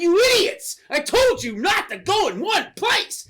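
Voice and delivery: high, loud villain rant; hammed-up exasperated yelling; mock furious scolding. Stripe scolding gremlins who gathered in one place, mock fury.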